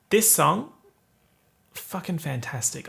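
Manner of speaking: ordinary talk, nothing marked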